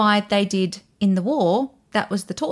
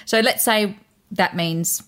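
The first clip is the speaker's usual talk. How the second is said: ordinary delivery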